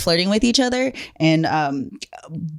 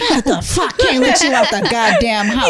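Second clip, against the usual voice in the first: laughing, exaggerated disgust at a friend; hammed-up outrage, laughing; mock-disgusted roast; 3 semitones higher than usual. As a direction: roast the outfit like an old friend would.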